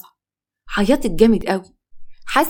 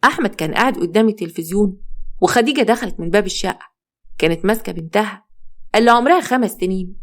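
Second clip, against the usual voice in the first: ordinary delivery, nothing marked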